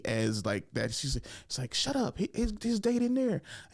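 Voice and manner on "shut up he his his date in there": high-pitched mock hushing; imitated scolding girl. Girl shushing the guy in the bathroom, high mock scolding. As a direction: imitate her in a much higher voice.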